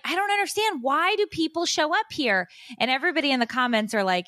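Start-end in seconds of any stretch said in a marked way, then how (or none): 0.0-2.5 high-pitched exasperated outburst; retold rant. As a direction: replay an indignant rant, pitched way up. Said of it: "I'm just raging on this live and screaming".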